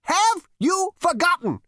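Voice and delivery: put-on slow, pressing repeat, high old voice; insistent. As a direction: insist on an answer. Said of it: "insisted the ghost".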